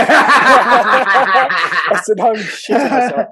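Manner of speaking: loud laugh through the words; laughing hard while retelling the fear. Real, not acted. Laughing loudly to a friend about being scared.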